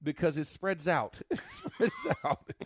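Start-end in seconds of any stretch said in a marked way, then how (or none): 1.2-2.5 laughing. Real, not acted. Laughing mid-sentence.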